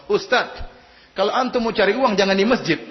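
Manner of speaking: high, loud jeering taunt, laughing through it; mocking madman. Madman taunting the preacher at the mosque, loud mocking jeer.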